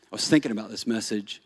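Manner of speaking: quiet, reflective telling; soft story narration. Acted. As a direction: lean in and remember. Soft storytelling after preaching.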